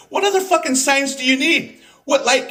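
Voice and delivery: a cracking, incredulous cry of frustration; exasperated. Real, not acted. Ranting at Democrat lawmakers, exasperated high outburst. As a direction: pitch shoots way up in disbelief.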